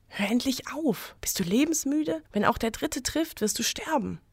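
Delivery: an inner voice begging him to stop, hushed and high; urgent inner voice. A narrator's reading. The voice in his head warns Wilhelm, soft urgent plea. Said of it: "sagte die Stimme in seinem Kopf".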